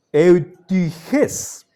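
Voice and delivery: eutiges as eu-tü-JÉS, syllable by syllable; Greek pronunciation. Shown as a demonstration. Teacher reads the second example, careful syllable-by-syllable Greek. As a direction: round the i of eutiges to ü, stress the end. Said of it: "En el primer caso suena eu".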